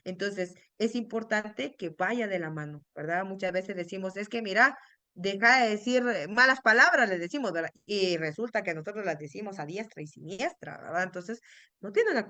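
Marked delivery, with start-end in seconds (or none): 4.2-7.1 put-on louder, scolding a child for swearing; scolding parent. Mother scolds her child for saying bad words, louder. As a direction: snap at the kid to stop cursing.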